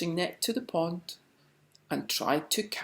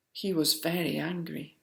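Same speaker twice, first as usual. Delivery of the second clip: plain reading voice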